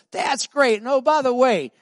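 upbeat reply. Retold or imitated. Pastor's retold reply, high and loud.

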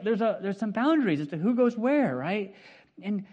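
normal talking voice

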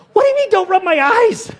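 wife Anna in the car, high and alarmed; imitating his wife. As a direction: voice her panic in the car.

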